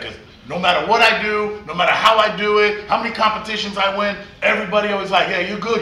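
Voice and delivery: loud, high retold grievance; acted-out frustration, pitched high and pushed; exasperated rant. Acting out exasperated rant to rival.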